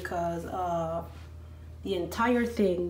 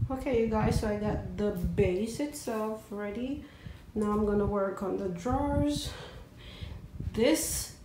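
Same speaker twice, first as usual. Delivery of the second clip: ordinary talk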